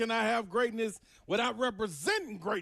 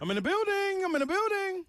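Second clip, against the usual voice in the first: hype entrance; 9 semitones higher than usual. Acted. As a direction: announce yourself with swagger.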